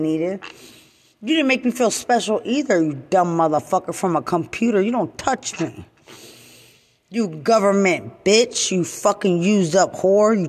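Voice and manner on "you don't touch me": ordinary talk, nothing marked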